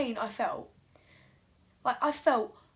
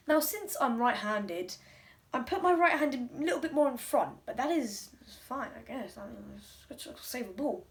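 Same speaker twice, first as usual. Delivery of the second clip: usual speaking voice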